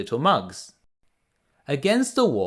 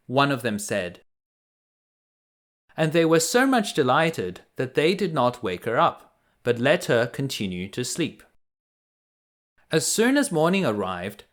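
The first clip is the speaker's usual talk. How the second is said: plain reading voice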